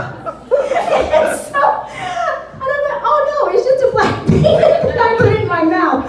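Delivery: high laughing, words tumbling fast; can barely talk for laughing; laughing through it. Real, not acted.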